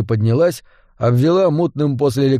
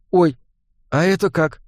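higher-pitched, mildly amazed question; 4 semitones higher than usual. A narrator's reading. Listener astonished by the reanimated hen, mild amazement. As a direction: ask in puzzled surprise. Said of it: "изумился Жига".

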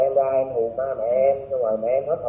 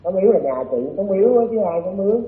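speaking up on a weak phone line; louder so the caller can hear; to someone hard of hearing; 7 semitones higher, 5 dB louder than usual. Real, not acted. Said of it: "Anh nói lớn lên [name] ơi".

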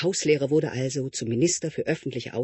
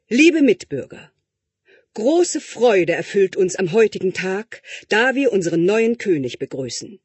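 loud, higher, solemn oration; formal speech; 6 semitones higher, 6 dB louder than usual. Acted. Minister addressing citizens at the coronation, loud and solemn. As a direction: address the crowd grandly.

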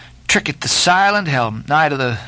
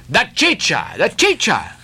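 cry; 4 semitones higher than usual. Acted. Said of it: "cried Don Pedro".

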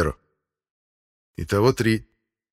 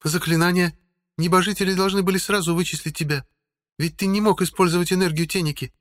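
higher, probing woman's voice; female voice; 11 semitones higher, 3 dB louder than usual. A narrator's reading. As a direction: question him as a sharp woman. Helga questions the hermit.

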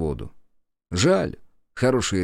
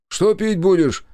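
angry shout from the kitchen, higher and louder; from another room; 10 semitones higher, 5 dB louder than usual. A narrator's reading. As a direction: call out angrily from the next room. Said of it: "сердито крикнул из кухни".

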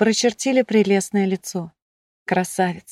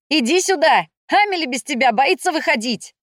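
high, urgent calling voice; shouting over crowd; 7 semitones higher than usual. A narrator's reading. Shefi summons Iv over a roaring crowd, calling out. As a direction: call out to be heard over noise. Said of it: "закричал Шефи, перекрывая восторженный рёв толпы".

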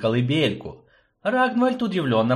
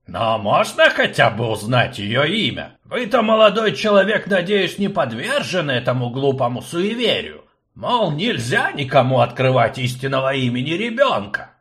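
put-on high, loud, fussy old professor; fussy old man; 3 semitones higher, 3 dB louder than usual. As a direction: play the fussy old rector. Akcium prying.

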